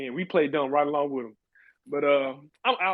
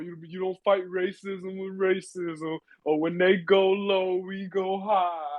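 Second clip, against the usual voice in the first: slow, sanctimonious lecturing voice; mock the do-gooders slowly and sweetly; mock preachy scold; 4 semitones higher, slower than usual. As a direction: drag it out like a smug moralizer. Mocking preachy respectability quote.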